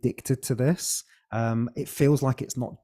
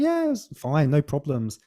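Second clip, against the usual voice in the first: much higher voice, breezy and unbothered; mum's breezy reply; 3 semitones higher, 3 dB louder than usual. Retold or imitated. Guest voicing his mum on the phone about his birth, breezy and higher. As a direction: toss it off lightly like a mum brushing it aside. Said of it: "She's like".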